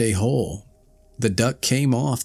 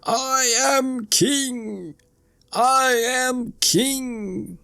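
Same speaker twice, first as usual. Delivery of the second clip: high, slow, tiny crowing voice; high little bird crowing from below; small voice; much slower than usual. A narrator's reading. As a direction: call up from the mouse hole, high and drawn out. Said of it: "with his small voice".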